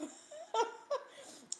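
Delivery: high-pitched burst of laughter; real laugh. Real, not acted. Streamer laughing at a viewer's comment, high real laugh.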